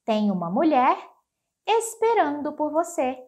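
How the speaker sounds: ordinary delivery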